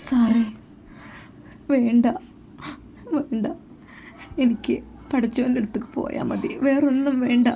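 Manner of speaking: Muhsina's voice. Acted. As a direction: plead softly as the frightened girl.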